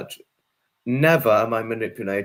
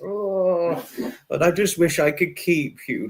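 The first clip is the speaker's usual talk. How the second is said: usual speaking voice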